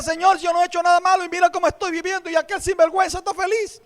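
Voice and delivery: fast, aggrieved reproach to God; complaining to God. Retold or imitated. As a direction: protest the injustice in a rush. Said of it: "yo le reclamaba a Dios".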